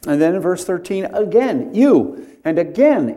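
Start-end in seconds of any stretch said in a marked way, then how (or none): 1.7-2.3 You lifted higher and louder; emphatic you. Shown as a demonstration. Pastor reading verse thirteen, emphatic You. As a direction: mark the word You hard. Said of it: "it's emphatic".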